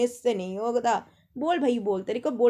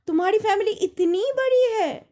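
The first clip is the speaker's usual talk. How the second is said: high, loud, startled mock question; surprised actress; 8 semitones higher, 4 dB louder than usual. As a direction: gasp it out like a film heroine amazed. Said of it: "तो उसने suddenly बोला". (Film की heroine की तरह ऊँची, चौंकी हुई आवाज़)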